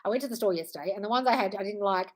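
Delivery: ordinary talk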